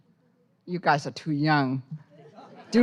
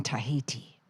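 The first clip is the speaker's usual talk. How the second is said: whisper; 5 semitones lower, 10 dB quieter than usual. Acted. Poem line ending hushed.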